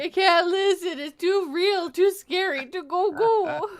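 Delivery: high, slow, whiny plea; mock fright. Playing the scared listener, high mock-frightened whine. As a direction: draw it out in playful dread.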